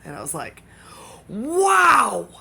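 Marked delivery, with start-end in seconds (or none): 1.3-2.3 a loud, much higher squeal of wow; shrieked hammed-up wow; mock amazement. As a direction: overplay the thrill. Reacting in retold exchange.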